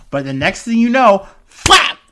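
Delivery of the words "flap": sudden loud, high smack sound; imitated slap noise. Telling how the actor hit the comedian, loud slap noise. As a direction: burst out with a cartoon-like slap.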